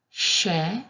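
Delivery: "she" said as a clean sh plus e, held apart; isolated Italian she. Shown as a demonstration. Teacher modelling the sound on its own, carefully. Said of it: "se pronuncia como she".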